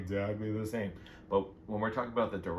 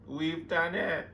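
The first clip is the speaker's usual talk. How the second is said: high, drawn-out cry of triumph; emotional squeal; 8 semitones higher, much slower than usual. Real, not acted. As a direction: stretch it out high, overcome with joy. Celebration repeated as squeal.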